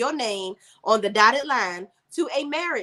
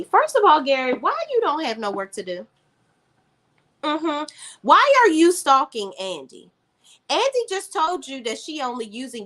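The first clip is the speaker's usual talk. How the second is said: sassy scolding; 6 semitones higher, 3 dB louder than usual. Real, not acted. Scolding a TV character.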